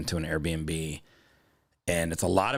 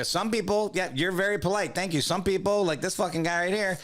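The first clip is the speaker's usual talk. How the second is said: high, passive-aggressive griping; retold rant meant to be overheard; pointedly loud talk; 11 semitones higher than usual. Customer complaining so the line-cutter hears, pointedly loud. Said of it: "talking, like, really loud".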